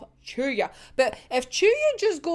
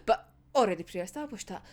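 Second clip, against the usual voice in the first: normal talking voice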